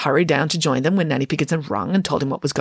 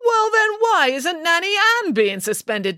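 high indignant demand; 13 semitones higher than usual. A narrator's reading. Nanny Piggins confronts the headmaster, high and demanding. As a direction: insist on it, outraged. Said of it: "demanded Nanny Piggins".

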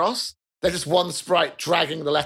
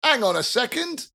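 suspicious prisoner; 6 semitones higher, slower than usual. Acted. Suspicious NPC stops them.

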